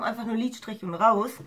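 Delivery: normal talking voice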